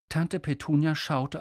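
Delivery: plain reading voice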